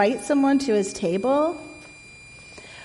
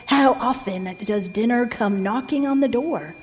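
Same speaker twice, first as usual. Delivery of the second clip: plain reading voice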